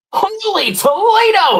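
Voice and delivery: high, slow, stretched-out exclamation; a big drawn-out Holy Toledo; catchphrase shout. Acted. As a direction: sell it as your signature line. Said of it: "my new catchphrase".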